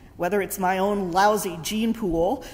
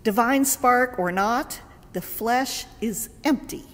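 ordinary delivery

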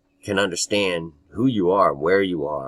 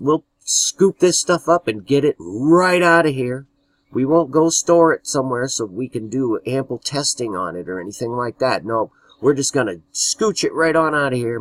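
eager yes-man voice, higher and louder; mock the fawning servant; cowed obedience; 6 semitones higher, 4 dB louder than usual. Mocking a worker obeying Bush, Rumsfeld.

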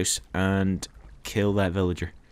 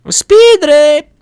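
high, stretched-out yell; very loud shout; 16 dB louder, much slower than usual. Real, not acted. Player calling through the cave, very loud high shout. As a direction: bellow it high and long. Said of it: "That's very loud".

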